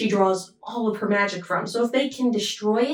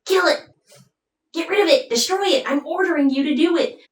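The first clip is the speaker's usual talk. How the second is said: higher, insistent orders, speeding up at the end; commanding sorceress; 7 semitones higher, faster than usual. Retold or imitated. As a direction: push him hard like a cruel queen. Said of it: "she's like".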